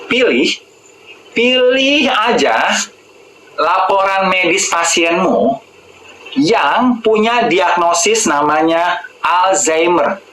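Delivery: deliberate, drawn-out words; slow dictation. Shown as a demonstration. Coaching viewers what to ask medics, slow dictation. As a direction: spell out the request slowly, phrase by phrase.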